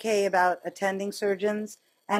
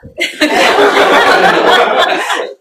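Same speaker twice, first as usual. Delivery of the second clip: a loud high burst of laughter; 11 semitones higher, 16 dB louder than usual. Real, not acted. Retelling the wife's retort, laughing loudly and high.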